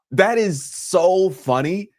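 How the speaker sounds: ordinary talk, nothing marked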